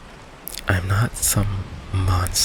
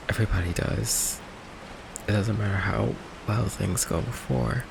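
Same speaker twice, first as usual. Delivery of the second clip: soft, halting and resigned; quiet hurt; 6 dB quieter than usual. Acted. Vampire confessing that loved ones always leave, quiet hurt. Confesses everyone close runs away.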